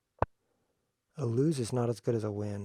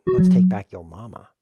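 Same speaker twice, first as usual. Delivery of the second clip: mock taunt; 10 dB louder than usual. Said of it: "I should have put".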